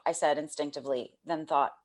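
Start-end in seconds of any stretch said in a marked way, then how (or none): none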